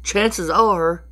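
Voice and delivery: ordinary delivery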